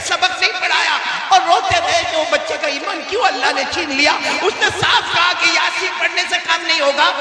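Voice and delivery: high, loud sermon build-up with laughter; impassioned preacher pitch; fiery preaching. Acted. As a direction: rouse the crowd, rising to a cry. Preaching at full force.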